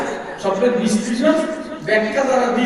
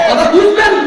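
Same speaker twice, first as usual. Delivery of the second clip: high, raised call to the crowd; 10 semitones higher, 7 dB louder than usual. Real, not acted. Preacher demanding a response from listeners, calling out. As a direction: push the crowd for an answer.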